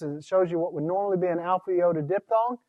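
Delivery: ordinary talk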